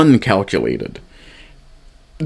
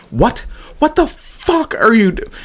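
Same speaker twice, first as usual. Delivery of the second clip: much higher, slow, disbelieving; stunned what-the-hell; baffled outrage; 11 semitones higher than usual. Real, not acted. Incredulous reaction to Cage.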